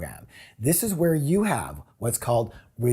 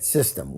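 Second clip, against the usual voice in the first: system said low and falling; emphasized word; 11 semitones lower, 4 dB quieter than usual. Shown as a demonstration. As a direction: lean down hard on system. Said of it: "I want to emphasize the word".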